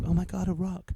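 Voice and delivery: mock wonder. Mocking a spiritual person.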